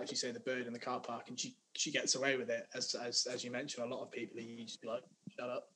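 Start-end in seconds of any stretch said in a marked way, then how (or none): none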